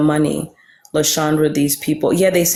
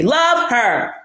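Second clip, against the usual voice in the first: ordinary talk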